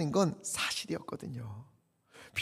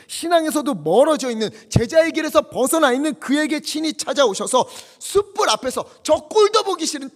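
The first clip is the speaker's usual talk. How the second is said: loud, high, swelling preaching; pleading crescendo from the pulpit; preaching climax; 6 semitones higher, 8 dB louder than usual. Acted. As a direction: build it up with fervor.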